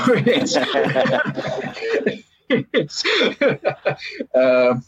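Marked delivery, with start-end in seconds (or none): 0.1-4.3 breaks into high laughter mid-sentence. Real, not acted. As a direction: laugh through the words at your own joke. Laughs at his own joke.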